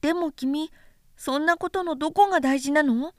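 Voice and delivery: put-on light, high boyish voice, curious; a small boy asking innocently; little prince voice. A narrator's reading. Little prince asking the vain man, high childlike voice.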